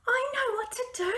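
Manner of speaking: high, loud pleading little dragon; begging Fergus. Acted. Fergus begging the boss dragons, high and loud. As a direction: beg eagerly to be sent.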